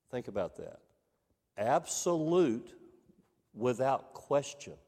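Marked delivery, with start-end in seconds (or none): 1.6-4.7 Absolute pitched high and drawn out, then without question; slow, heavy emphasis on Absolute; emphatic. Shown as a demonstration. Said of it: "Emphasis, without question".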